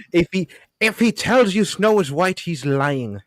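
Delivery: high-pitched, badly faked Scottish accent; attempted Scottish accent. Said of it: "I can't do a Scottish accent".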